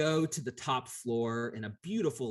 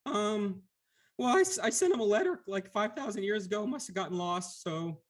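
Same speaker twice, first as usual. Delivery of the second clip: timid Lemure; 11 semitones higher, 4 dB louder than usual. Acted. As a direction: squeak it out like a weepy blob creature. GM voices Lemure.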